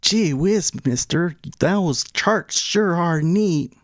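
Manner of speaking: high, slow, gee-whiz schoolboy voice; wholesome 1950s kid. Pretend. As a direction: play an eager 1950s kid in awe.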